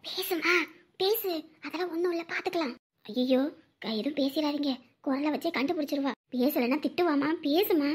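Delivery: very soft, low murmur; hushed coaxing. Acted. Grandparents urge the child to talk to mother, hushed. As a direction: coax quietly so the phone won't pick it up.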